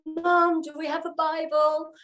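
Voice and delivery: high call up the stairs to mum; calling upstairs. Retold or imitated. Calling up the stairs to mum, high call. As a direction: shout it up the stairs, pitched high. I shouted up and I said.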